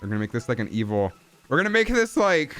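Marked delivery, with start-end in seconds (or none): 1.5-2.6 high sing-song villain voice. Shown as a demonstration. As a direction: lift it up high like a sea witch. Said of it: "what's Ursula from The Little Mermaid?".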